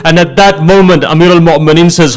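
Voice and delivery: ordinary delivery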